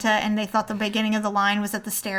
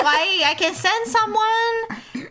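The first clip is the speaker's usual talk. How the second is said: flustered hotel clerk; 11 semitones higher, slower than usual. Retold or imitated. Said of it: "he's like".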